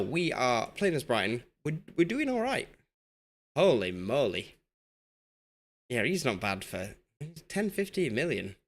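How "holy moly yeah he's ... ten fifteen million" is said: ordinary talk, nothing marked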